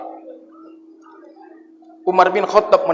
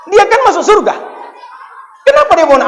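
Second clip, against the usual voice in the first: exclaimed; 10 semitones higher than usual. Real, not acted. Preacher's rhetorical outburst to congregation.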